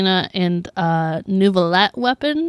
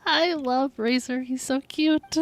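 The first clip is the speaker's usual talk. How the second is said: gushing; 5 semitones higher, 5 dB quieter, slower than usual. Real, not acted. Gushing over cute character.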